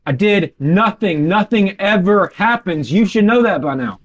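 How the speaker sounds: high, drawn-out denial; exasperated protest. Acted. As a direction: stretch out each defensive phrase. Dale protests under questioning.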